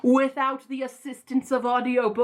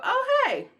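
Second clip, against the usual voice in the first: high, casual little reaction; mild mock surprise; 11 semitones higher, slower than usual. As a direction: lightly toss off a pleased discovery, pitched up. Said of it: "And I go".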